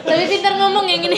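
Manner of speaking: teasing line spoken through laughter, quick and high; laughing tease. Real, not acted. Actress teasing her co-star about his answer, laughing. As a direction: giggle while ribbing your co-star.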